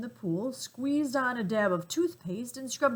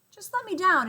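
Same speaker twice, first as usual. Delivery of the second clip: much higher, louder plea; pleading; 11 semitones higher, 7 dB louder than usual. A narrator's reading. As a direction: beg to be put down. Father begs from the tusk.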